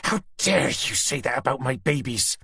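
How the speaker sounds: snarling, outraged; malicious snarl. A narrator's reading. Susan snarling at Tanner over her babies. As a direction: spit it through a snarl. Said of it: "Through a malicious snarl".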